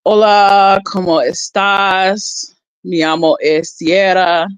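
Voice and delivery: slow, careful Spanish phrase with a hint of Spanish accent; a light Spanish accent, asked to roll her R's. Shown as a demonstration. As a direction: say it like a beginner showing off Spanish. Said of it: "like, Spanish, like, um".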